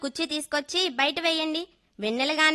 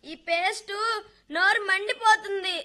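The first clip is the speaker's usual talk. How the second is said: put-on a child sobbing, higher in pitch, that his mouth burns; child crying; 5 semitones higher than usual. Young son cries to his father about the burning paste, sobbing. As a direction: wail it through tears like a small boy in pain.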